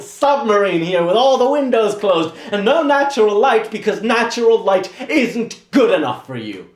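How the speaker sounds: loud, high, escalating tirade; furious rant. Acted. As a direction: let the anger build to a shout. Assistant shouting at the painter.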